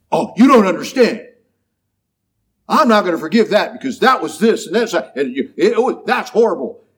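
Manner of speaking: lightly hammed-up, whiny protest; mock indignant excuse. Imitating someone refusing to forgive, hammed-up indignation. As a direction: parody the person who won't forgive. Mocking a grudge-holding excuse.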